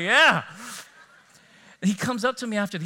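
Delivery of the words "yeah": loud, high cheer, reliving the excitement; excited. Retold or imitated. Coach reliving the team winning the game, excited cheer. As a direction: burst out with an excited whoop. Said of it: "I was all excited".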